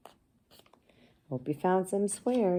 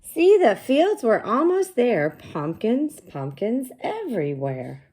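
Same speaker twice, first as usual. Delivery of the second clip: high, loud, slow storybook read-aloud; sing-song read-aloud for young kids; reading to children; 7 semitones higher, 8 dB louder than usual. Acted. Teacher reading the book aloud to her class, slow and bright.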